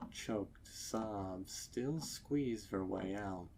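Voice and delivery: plain reading voice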